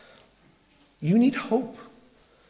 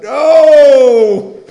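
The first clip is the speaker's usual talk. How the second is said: long, loud, high-pitched wail of protest; big acted howl of disbelief; dismayed cry; 9 semitones higher, 15 dB louder than usual. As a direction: cry out in dismay, drawing the word out. Retells heartbroken reaction to friend's advice.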